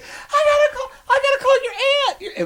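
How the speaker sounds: very high, laughing frantic voice; high hysterical imitation; frantic mother. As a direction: squeak it out in a mock panic. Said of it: "She's like".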